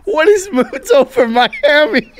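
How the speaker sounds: high-pitched, cracking up while asking; laughing question. Real, not acted. Co-host asks what the odd dish is, laughing helplessly. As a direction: squeak the question out through laughter.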